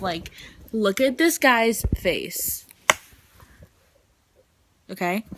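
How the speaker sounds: normal talking voice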